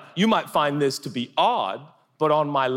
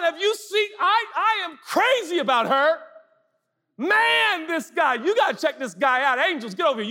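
God proudly showing off. Acted. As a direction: brag like a delighted proud father. God bragging about his children.

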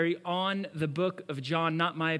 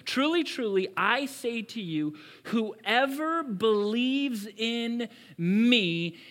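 raised, ringing quote of Jesus; emphatic scripture quote; 5 semitones higher, slower than usual. Acted. Preacher proclaiming Jesus' words to the church, emphatic. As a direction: proclaim it to the congregation.

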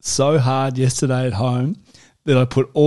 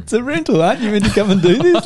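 high, loud, fast mock comeback through laughter; mock retort; 10 semitones higher, 6 dB louder, faster than usual. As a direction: fire back at the gardener, laughing.

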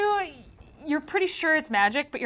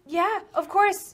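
high pixie voice; 7 semitones higher, much slower than usual. Acted.

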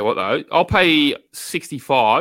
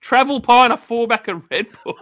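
laughing repeat; 6 semitones higher, 3 dB louder than usual. Real, not acted. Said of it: "laughing repeat".

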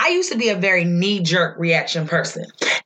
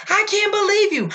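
high, incredulous mock outburst; exasperated disbelief, high but not shouted; retold snap of anger; 10 semitones higher than usual. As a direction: re-enact being mad, pitch jumping up. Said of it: "when I get mad, mad, I just snap".

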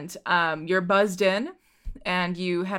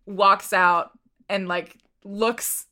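ordinary talk, nothing marked

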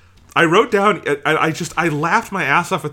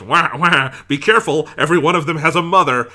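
loud squawking Penguin quote, pitched up; a louder, raised Penguin voice fussing over vials; Penguin impression; 4 semitones higher, 7 dB louder than usual. Two podcast hosts, one quoting Penguin's ad-lib, loud squawk. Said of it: "he has this line where he goes".

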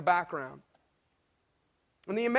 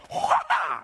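high, sudden mock kung fu cry; martial-arts yell; 9 semitones higher, 3 dB louder than usual. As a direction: let out a playful sword-chop "hwaa". Imitating a martial-arts sword chop.

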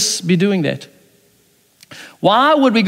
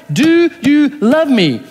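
slow, higher "do you love me"; Jesus pressing Adam for an answer, slow and searching; slow pressing question; 5 semitones higher, much slower than usual. Acted. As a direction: draw the question out, gently insistent. Jesus asks Adam again.

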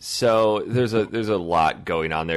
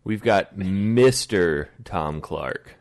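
usual speaking voice

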